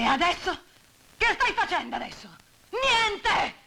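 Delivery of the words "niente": Niente! screamed high and loud; furious shout. Acted. Widow screaming at Masino, furious. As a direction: let the anger explode on Niente!.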